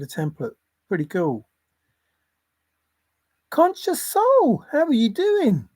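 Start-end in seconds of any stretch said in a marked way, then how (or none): 3.5-5.7 very high, slow sing-song hello; excited greeting. Real, not acted. Greeting Conscious Soul in chat, high sing-song. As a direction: stretch the hello up high and happy.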